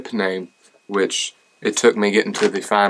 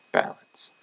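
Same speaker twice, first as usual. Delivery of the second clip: whispered aside; 8 dB quieter than usual. Real, not acted.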